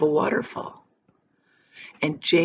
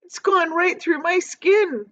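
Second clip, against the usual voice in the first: much higher, slow groan; groaning earthworm; 11 semitones higher, 3 dB louder than usual. A narrator's reading. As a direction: moan it in misery. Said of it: "the earthworm groaned".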